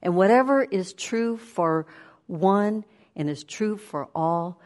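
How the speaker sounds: normal talking voice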